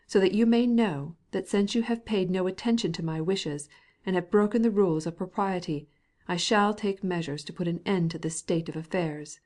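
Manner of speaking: ordinary delivery, nothing marked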